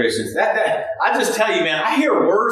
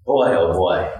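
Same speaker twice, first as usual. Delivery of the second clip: put-on much lower, weary "boy, oh boy"; James sighing; 14 semitones lower than usual. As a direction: drop way down like a disappointed elder. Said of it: "I can see James just going".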